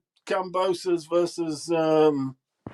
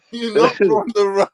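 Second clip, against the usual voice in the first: imitating Tunde; 5 semitones higher than usual. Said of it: "Tunde makes me laugh".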